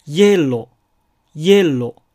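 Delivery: イエロー said slowly, loud, syllable by syllable; Spanish hielo. Shown as a demonstration. Teacher modelling イエロー for the class. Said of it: "イエローではありません".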